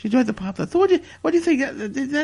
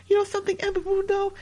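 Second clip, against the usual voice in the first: high touched voice; 5 semitones higher, 3 dB quieter than usual. Acted. Touched quote to his girlfriend.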